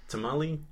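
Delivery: TAMALE as tuh-MAH-lee; heard pronunciation. Shown as a demonstration. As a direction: end tamale on a "lee" syllable. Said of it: "only way that I've really heard it pronounced".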